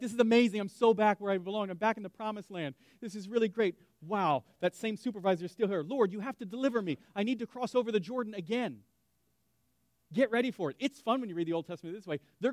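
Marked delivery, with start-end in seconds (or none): 0.0-8.8 loud gushing then pleading; overjoyed then deflated believer; mock delight turning sour. Believer back at the old job, mock delight then plea. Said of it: "and you're like".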